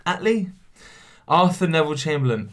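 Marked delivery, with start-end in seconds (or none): 0.1-0.5 Attlee asked up high; unsure. Real, not acted. Unsure how to say a name, asking higher. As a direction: question the name.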